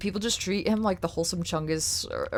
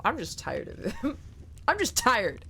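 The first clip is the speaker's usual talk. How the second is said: usual speaking voice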